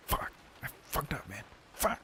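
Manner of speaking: unvoiced, breathy curse at a slip; whispered swearing. Real, not acted. Vlogger flubbing the line, cursing in whisper. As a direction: hiss the mistake under your breath. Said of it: "No more whispering".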